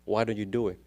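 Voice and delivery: ordinary talk, nothing marked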